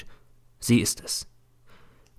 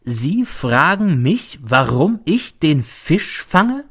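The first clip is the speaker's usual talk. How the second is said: slow, each word weighted; slow, ominous, word by word; every word stressed; 5 semitones higher, much slower than usual. A narrator's reading. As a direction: lean on every single word. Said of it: "als betonte er jedes Wort".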